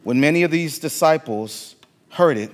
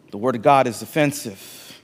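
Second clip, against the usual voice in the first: ordinary delivery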